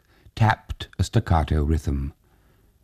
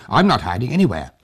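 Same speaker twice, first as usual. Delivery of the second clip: quick, scornful refusal; with distaste; 6 semitones higher, 4 dB louder, much faster than usual. A narrator's reading. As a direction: toss it off with distaste. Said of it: "said with distaste".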